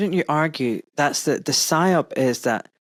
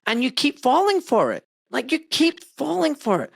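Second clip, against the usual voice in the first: exasperated; 7 semitones higher than usual. Real, not acted. Venting frustration at duped people.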